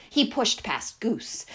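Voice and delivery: ordinary delivery, nothing marked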